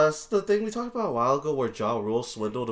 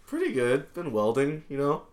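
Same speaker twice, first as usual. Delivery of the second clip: raised, louder impression of a co-host; mock co-host voice, higher than your own; Mike; 7 semitones higher, 4 dB louder than usual. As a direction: imitate your co-host, pitched up and projected. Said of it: "That's my mic impression".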